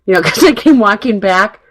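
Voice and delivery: ordinary delivery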